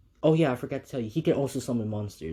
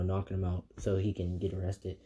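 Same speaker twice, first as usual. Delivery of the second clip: ordinary delivery, nothing marked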